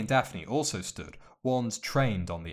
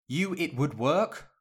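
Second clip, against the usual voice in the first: eager high question; excited; 7 semitones higher, 3 dB louder than usual. Acted. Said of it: "Hermione's excited voice".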